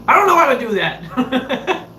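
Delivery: high, slow, exasperated whine; hammed-up protest with a laugh in it; mock helpless protest. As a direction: imitate a flustered chief. Imitates chief's helpless protest.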